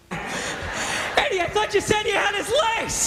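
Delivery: very high, strained, winded mock agony; doubled over in pain. Guard complaining to Eddie after the struggle, mock pained squeal. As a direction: squeeze it out as if badly hurt. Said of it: "guards walking out doubled over like".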